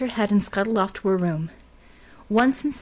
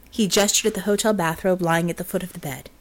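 ordinary delivery, nothing marked

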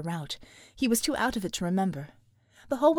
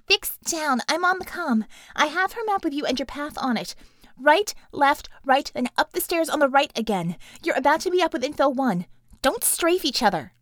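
higher voice calling over comm; radio call; 5 semitones higher, 4 dB louder than usual. Acted. Bella calling the team over the comm. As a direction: call sign like a radio operator.

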